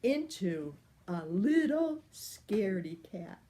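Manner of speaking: ordinary delivery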